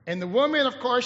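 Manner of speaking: ordinary talk, nothing marked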